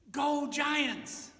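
high, loud fan yell; sports cheer. Acted. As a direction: cheer for your team from the stands. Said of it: "We cheer for that a lot".

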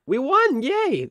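high, excited victory cheer. Real, not acted. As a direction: cheer the win, way up. Winning a battle.

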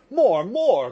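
loud, high heated outburst; hot cry. A narrator's reading. Mitya correcting the lawyer's sum, heated loud cry. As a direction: cry it out hotly. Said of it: "Cried Mitya hotly".

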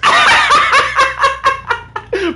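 shrill mocking laugh, loud and high; high loud cackle. Real, not acted. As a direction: cackle at the beaten opponent. Laughing at opponent.

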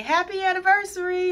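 high, fast, bright-voiced friend's greeting; cheery greeting. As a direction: chirp it like a friend calling. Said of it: "will send me a text message or call me and say".

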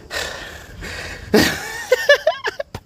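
very high-pitched squeal of laughter; laughing in a piercingly high register. Real, not acted.

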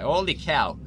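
surprised gamer shout; surprised exclamation. Real, not acted. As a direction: blurt it out, amazed. Reacting to level clear.

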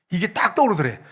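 ordinary delivery

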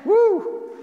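high, loud celebratory woo; exultant high whoop from the pulpit; joyful whoop. Real, not acted. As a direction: let out a jubilant shout of praise. Shout of praise after climax.

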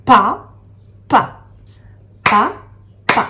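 "Pa," then "pa." as two separate beats; spaced, one beat per syllable; syllables split apart. Shown as a demonstration. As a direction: tap out "Pa, pa." syllable by syllable. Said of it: "il y a deux syllabes qui sont les mêmes".

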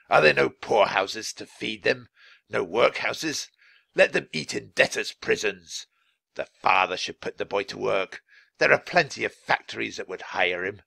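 put-on miserly innkeeper, dismissive; scornful innkeeper character voice; stingy innkeeper, scornful. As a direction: sneer at the poor as a tight-fisted innkeeper. Stingy innkeeper rants about the poor.

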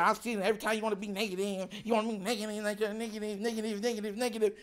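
low, soft mocking mumble; nagging critics. Viewers accusing the host of negativity, mocking drone. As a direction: drone the complaint on repeat, under the breath. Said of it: "And y'all was like".

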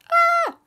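sudden high startled shriek. Acted. Nest slips from their hands, high shriek. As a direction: yelp as the nest falls.

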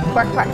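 quacking like a duck; short playful quacks. Retold or imitated. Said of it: "Can you make the sound of a duck?".

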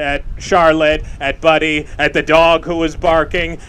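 ordinary talk

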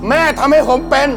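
normal talking voice

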